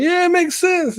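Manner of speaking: high, loud imitation of giddy fans; mock giddy. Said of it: "people just getting giddy over it".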